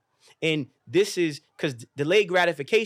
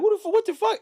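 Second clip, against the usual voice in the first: much higher startled outburst; shocked yelp; 4 dB louder than usual. Retold or imitated. Startled by his older self appearing, high shocked yelp. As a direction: blurt it in total disbelief.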